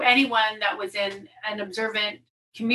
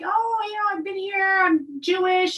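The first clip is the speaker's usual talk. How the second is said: imitated phone chatter, pitched way up; high-pitched self-introduction; 10 semitones higher than usual. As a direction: re-enact introducing yourself in a high voice. Acting out introducing herself by phone.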